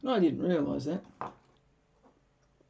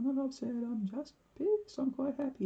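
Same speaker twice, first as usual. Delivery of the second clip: high-pitched cheerful quote; 8 semitones higher than usual. Retold or imitated. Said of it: "And I'm going".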